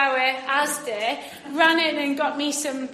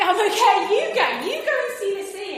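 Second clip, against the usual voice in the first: high, fast flustered brush-off; mock scared friend backing out; nervous friend; 7 semitones higher, 5 dB louder, much faster than usual. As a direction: hurry to wave it away, nervously. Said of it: "she was just like".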